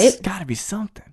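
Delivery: breathy hushed aside; conspiratorial whisper between the two. Real, not acted. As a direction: whisper it like a secret.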